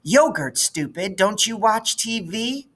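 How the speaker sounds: a smug fifth grader mocking a classmate; snotty schoolgirl. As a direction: talk down to her like a know-it-all. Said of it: "This from Wanda K Moore".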